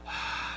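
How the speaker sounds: soft acted sigh; imitated sigh. Preacher acts out how Jesus might have sighed, soft sigh. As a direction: let out a quiet sigh. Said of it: "just kinda let out a sigh".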